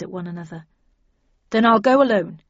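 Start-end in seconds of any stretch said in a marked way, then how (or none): 1.5-2.4 loud, decided statement; with finality. A narrator's reading. Snell insisting on going in alone, with loud finality. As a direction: shut down the argument firmly. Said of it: "replied Snell with finality".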